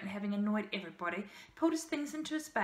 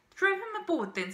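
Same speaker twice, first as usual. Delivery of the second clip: high, louder dismissive order; brusque command; 10 semitones higher, 5 dB louder than usual. A narrator's reading. Man orders ballerina to remove the boy, brusque command. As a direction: give a brisk order to be rid of him.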